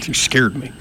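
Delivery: laughing it off after a fright; breathless little laugh through words. Real, not acted. Laughing after being startled.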